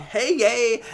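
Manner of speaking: high, loud little hooray; mock delighted cheer. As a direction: imitate cheering over a new present. [name] cheering her new laptop.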